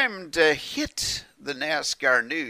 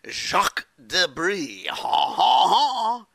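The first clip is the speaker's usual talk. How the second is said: slow mock French with a loud high "ha, ha, ha"; bad French accent; 5 semitones higher, 3 dB louder, slower than usual. Radio host announces the return of Jacques Debris, bad French accent. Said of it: "my bad French accent".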